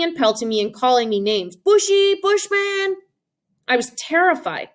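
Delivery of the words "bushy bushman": high, mocking playground taunt; kids taunting. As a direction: jeer it like a pack of bullies.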